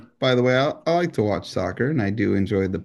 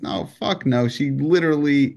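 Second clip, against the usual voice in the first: usual speaking voice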